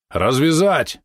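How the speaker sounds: high, rising command; shouted order. A narrator's reading. Nervous merchant orders the captive untied, shouting. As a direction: call the order out. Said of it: "крикнул купец".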